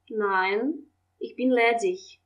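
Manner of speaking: usual speaking voice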